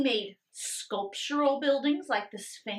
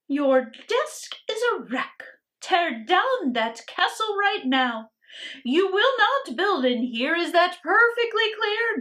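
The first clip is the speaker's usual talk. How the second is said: sharp scolding, loud at first; scolding teacher; 7 semitones higher, 7 dB louder than usual. Acted. Teacher scolding Iggy for building in class, sharp scolding. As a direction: scold the boy for his chalk castle.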